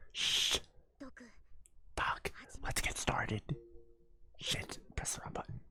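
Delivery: nervous hushed whisper. Acted. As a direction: whisper it, tense and careful. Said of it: "You're whispering too".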